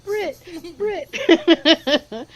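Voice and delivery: high, light mock cheering; playful cheer. Player cheering the squirrel's fist pumps, playful high cheer. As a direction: chant the name in a squeaky little cheer.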